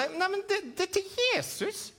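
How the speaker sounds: high puppet voice, matter-of-fact; puppet Edvin. Acted. Puppet Edvin telling the host why he holds the apple, high voice. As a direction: explain it plainly as the puppet.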